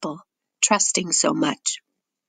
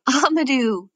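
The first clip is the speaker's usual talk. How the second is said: a higher, mildly raised shout of his name; excited call; 7 semitones higher than usual. Acted. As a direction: call out to a brother found again in the dark. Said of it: "Seydou's shouting".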